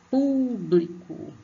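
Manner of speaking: "Público" high and slow, "Pú" stretched out; stress on first syllable. Shown as a demonstration. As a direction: call out "Público", pushing long on "Pú". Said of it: "vocês põem mais força".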